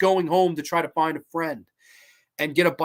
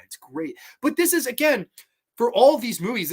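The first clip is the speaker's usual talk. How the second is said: usual speaking voice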